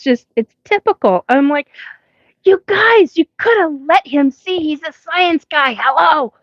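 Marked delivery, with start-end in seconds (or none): 2.4-6.3 exasperated protest. Retold or imitated. Retold protest at the aliens.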